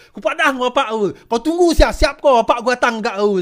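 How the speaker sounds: shouted high schoolboy threat, half laughing; angry child threat. Retold or imitated. Beaten schoolboy threatens the speaker with his father, shouting high. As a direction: bawl it like a sore loser calling for his father.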